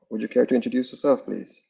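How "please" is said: usual speaking voice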